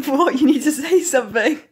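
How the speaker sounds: loud, laughing demand for a response; loud and giggly, egging someone on; laughing protest. Real, not acted. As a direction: protest through giggles, loudly. Laughing at mum's wordless reaction.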